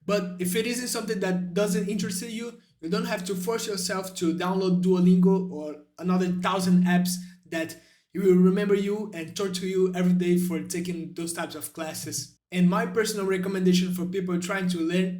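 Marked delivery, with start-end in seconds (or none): none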